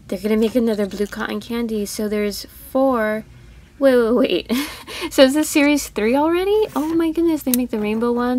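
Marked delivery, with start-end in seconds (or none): 3.8-8.4 real surprise, louder and higher with a laugh; surprised excitement. Real, not acted. Toy hunter spots an unexpected new series on the shelf, surprised excitement. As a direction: burst out in delighted disbelief.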